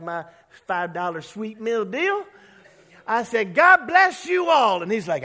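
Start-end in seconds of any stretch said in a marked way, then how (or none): 3.5-4.8 bold shout. Retold or imitated. Jumping up to be bold.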